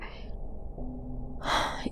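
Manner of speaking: long, soft breathy sigh; weary sigh. A narrator's reading. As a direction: let out a long, quiet sigh. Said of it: "he sighed".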